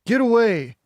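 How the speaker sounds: loud, high shove of a shout; angry shout. A narrator's reading. Bully shoving Margot, shouting angrily. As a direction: bark her away.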